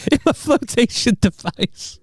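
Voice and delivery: high, slow teasing repeat; mocking echo. Real, not acted. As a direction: tease her fancy wording.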